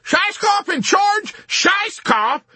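very high, slow hysterical shout. A narrator's reading. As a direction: shriek it in disbelief. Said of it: "composure went up in hysteria".

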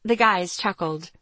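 usual speaking voice

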